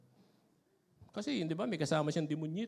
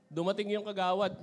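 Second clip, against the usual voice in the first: ordinary delivery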